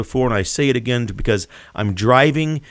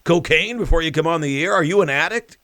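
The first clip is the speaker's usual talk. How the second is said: mock Biden retort; 5 semitones higher than usual. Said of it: "Then he asks the reporter".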